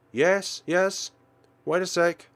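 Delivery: loud and high, caught off guard; flustered shout. Acted. Startled, answering knock at door.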